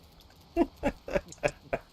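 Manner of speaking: loud burst of laughter; big hearty laugh. Real, not acted. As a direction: crack up out loud. Laughing at self-talk joke.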